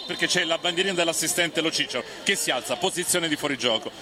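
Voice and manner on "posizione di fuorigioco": usual speaking voice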